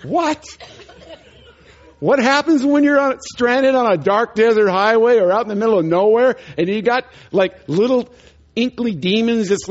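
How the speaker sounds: high, loud rhetorical outburst; rally the crowd with the question; worked-up preacher asking what you would do. Real, not acted.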